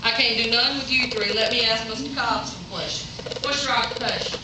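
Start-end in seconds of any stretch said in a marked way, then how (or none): none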